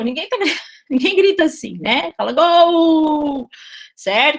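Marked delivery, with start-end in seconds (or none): 2.2-3.5 "gol" drawn out ending in a big U, "gou"; "gol" said slowly as "gouuu"; vocalised L. Shown as a demonstration. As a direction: stretch "gol" slowly into a long U. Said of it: "Com um baita de um U no final".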